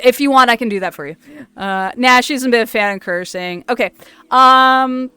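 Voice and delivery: ordinary talk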